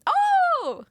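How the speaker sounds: very high delighted gasp; excited. Real, not acted. Sees the special team.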